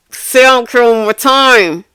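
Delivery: fed up, daring her to say it again; angry warning. Retold or imitated. Teacher warns defiant student not to repeat it, angrily.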